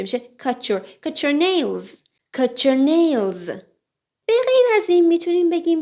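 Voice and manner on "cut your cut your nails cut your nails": "cut your" said as "cutcher"; linked quick form. Shown as a demonstration. Teacher explains fast speech, "cut your" linked with ch.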